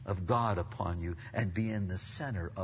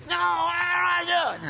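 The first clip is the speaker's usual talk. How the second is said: loud, high bratty shout; mock temper tantrum; 7 dB louder than usual. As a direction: imitate a child yelling a refusal. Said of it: "throw a little temper tantrum".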